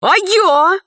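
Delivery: put-on high, sudden boyish interjection; blurted out. A narrator's reading. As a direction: burst in eagerly, afraid of being left out. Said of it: "вырвалось у Пашки Гераскина".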